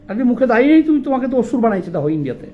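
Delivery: ordinary talk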